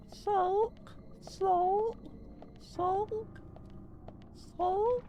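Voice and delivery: very high squeaky cartoon voice, rising each time; Meatwad impression. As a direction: squeak it like a tiny cartoon meatball. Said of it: "I know what Meatwad says".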